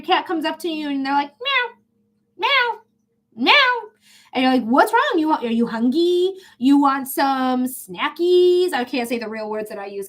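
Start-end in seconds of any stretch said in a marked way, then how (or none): 1.4-3.9 high, whiny cat meows; cat meowing. Acted. 4.6-8.7 cooing to the cat, the last words slow; baby talk to a pet. Acted. Talking sweetly to a cat.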